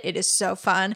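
usual speaking voice

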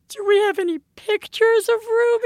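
mother voicing her daughter asking for photos; little girl asking. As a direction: ask it like a hopeful sad kid.

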